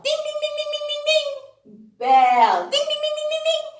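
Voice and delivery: high, light ding ding like a small bell; bell imitation. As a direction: ring out like a little bell.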